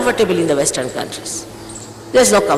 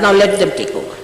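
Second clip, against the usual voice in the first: ordinary delivery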